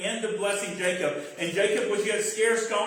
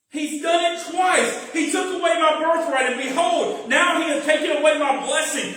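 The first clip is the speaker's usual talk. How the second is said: higher, louder desperate son pleading; anguished Esau; 6 semitones higher, 6 dB louder than usual. Acted. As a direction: cry out at being cheated twice.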